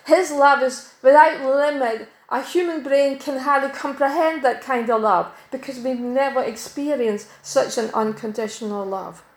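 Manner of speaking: normal talking voice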